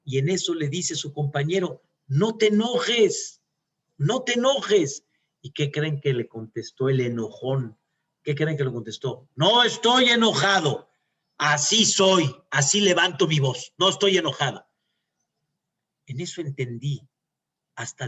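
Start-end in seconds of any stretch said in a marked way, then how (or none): 2.1-5.0 high, loud and slow "don't get angry"; calming plea. Retold or imitated. Companion pleading with angry friend, loud and slow. 9.4-14.6 loud, indignant insisting he's not angry; angry denial. Retold or imitated. Angry man denying anger to his companion, loud. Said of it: "empezó a levantar la voz".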